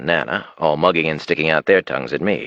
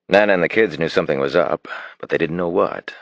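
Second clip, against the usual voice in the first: ordinary delivery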